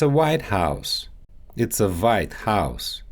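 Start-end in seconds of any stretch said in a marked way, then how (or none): 1.6-3.0 Russian accent. Shown as a demonstration. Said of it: "W and V sounds".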